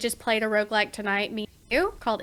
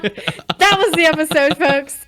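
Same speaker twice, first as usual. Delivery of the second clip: mock sign-off; 7 semitones higher, 7 dB louder than usual.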